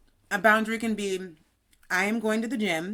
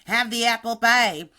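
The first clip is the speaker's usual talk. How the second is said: pushy relative. Retold or imitated. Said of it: "I was like".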